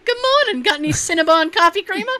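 high-pitched chirpy neighbor voice. Pretend. Neighbor asking next door for creamer, chirpy. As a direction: call over high and neighborly.

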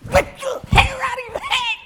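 high, loud hammed-up angry lady; high mock threat. Ladies voiced threatening husband.

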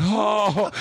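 high, acted-out "oh" of pain; mock pained cry. Teen as mom scratches new tattoo, pained.

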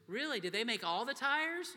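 put-on high, loud wide-eyed kid voice; little girl's amazed question; amazed child. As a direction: ask it like an amazed six-year-old. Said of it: "she goes".

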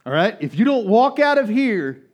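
loud, high, urgent preaching; preaching at full force. Acted. Pastor exhorts congregation loudly to spiritual maturity. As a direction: exhort the church to grow up.

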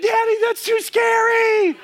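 very high, drawn-out mock wail; imitated crying child. Crying daughter tells dad it's too scary, mock wail. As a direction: wail it like a frightened little girl. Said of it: "and she's crying".

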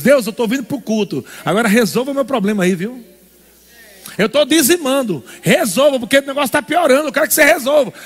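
bossing God around; pushy believer demanding God fix it; demanding churchgoer. Retold or imitated. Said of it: "voicing demanding believer".

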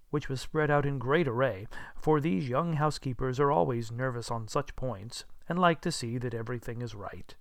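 plain reading voice

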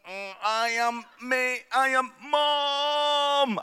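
put-on slow, halting little-girl voice; child actor. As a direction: stumble through it like a tiny nervous actor. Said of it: "little girls ... saying".

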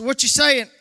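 high, loud mock challenge from a listener; a hammed-up sceptic in the pews; imagined challenger. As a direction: throw the doubter's question out loud.

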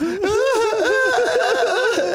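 shrieking laugh, very high; high burst of laughter. Real, not acted. Disgusted at the bar story, a high shrieking laugh. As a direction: laugh out loud, high and helpless.

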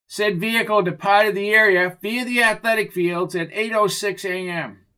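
high, stiff police-report voice; officious cop report. Acted. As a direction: read out your notes as a self-important officer. Officer reads from his notepad.

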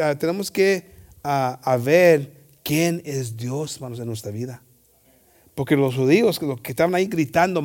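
ordinary delivery

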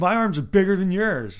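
loud, high drunk-challenger imitation; hammed-up taunting brag; mock belligerent boast. Retold drunk woman's belligerent boast.

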